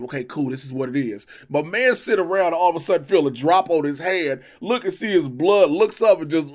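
normal talking voice

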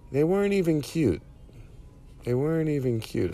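lightly voiced grumbling koala; a soft hint of a koala character. Koala home from a bad date, lightly voiced complaint. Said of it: "The thing about koala bears".

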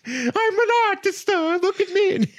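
very high, drawn-out show-off; hammed-up falsetto character voice; Paolo Gucci. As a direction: squeal it slowly, begging to be admired. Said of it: "he walks in, he's like".

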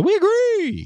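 very high, drawn-out sing-song; playful high-pitched mock cheer; sing-song agreement. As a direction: announce it in a squeaky high voice. Mock celebration of rare agreement.

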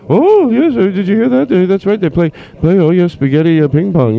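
high, excited passenger babble; a cruise guest thrilled by the news; gushing passenger. Acted. Excited cruise passenger gushes to another guest, pitched high.

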